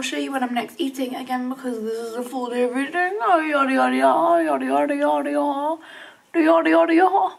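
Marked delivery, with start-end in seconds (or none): 3.2-7.3 singsong nonsense babble rising higher; silly babble. Pretend. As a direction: babble playfully, getting louder and higher. Silly nonsense babble, then weird.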